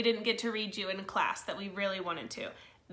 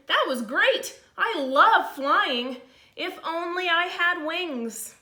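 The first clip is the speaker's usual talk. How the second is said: higher, louder, dreamy delight; thrilled slug; 6 semitones higher, 6 dB louder, much slower than usual. Acted. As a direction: savour the joy of flying. Norman loved flying.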